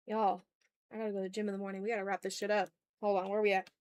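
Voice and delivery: usual speaking voice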